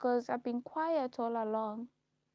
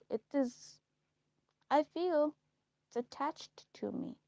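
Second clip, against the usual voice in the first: ordinary talk, nothing marked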